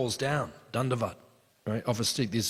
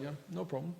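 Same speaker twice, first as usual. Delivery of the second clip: soft, higher, unbothered quoted reply; casual reassurance; 4 semitones higher, 11 dB quieter, faster than usual. Retold or imitated. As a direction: toss it off quietly as if effortless. Krishna's effortless reply to friends.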